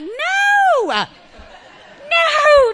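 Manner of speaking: high, loud laughing shout; mock alarmed buddies yelling; friends yelling no. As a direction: shout them down, half laughing. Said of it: "they're like".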